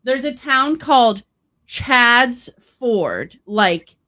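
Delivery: ordinary talk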